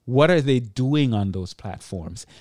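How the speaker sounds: ordinary talk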